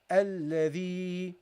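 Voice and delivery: Alladhi slow, with a long held EE; prolonged final vowel. Shown as a demonstration. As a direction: let the last vowel of Alladhi ring on. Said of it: "That's how you pronounce this".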